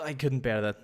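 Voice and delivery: ordinary talk, nothing marked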